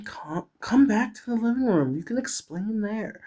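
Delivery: high, softening coaxing voice; soothing friend. Acted. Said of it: "she said soothingly".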